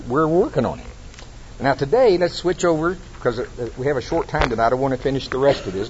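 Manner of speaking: ordinary delivery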